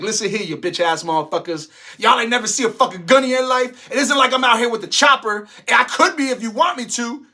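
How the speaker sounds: hammed-up threat to the haters; mock threatening apology. Comedian mocking critics, mock apology as loud threat. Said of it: "my apology would be like".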